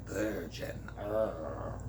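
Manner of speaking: low, hushed distaste; mock disgust trailing into a low "urgh". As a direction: say it softly, like a really dirty word. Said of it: "like it's a really dirty word".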